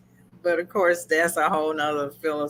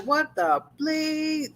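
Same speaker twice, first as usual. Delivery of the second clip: mock shock; 10 semitones higher, slower than usual. Cliff discovering his slaughtered family.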